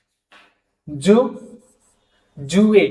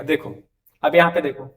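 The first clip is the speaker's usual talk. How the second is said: ordinary delivery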